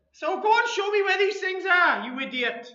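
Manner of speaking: exasperated Welsh wife, high and loud; Welsh accent. Acted. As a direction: order him about in a Welsh accent. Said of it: "in a Welsh accent".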